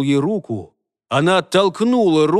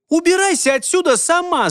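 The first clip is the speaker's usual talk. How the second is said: high-pitched angry retort; yelling; 13 semitones higher than usual. A narrator's reading. Girl to the fairy queen, yelling. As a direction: fling it back in a high yell. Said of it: "закричала она".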